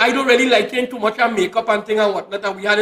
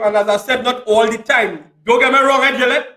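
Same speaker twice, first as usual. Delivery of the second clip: ordinary talk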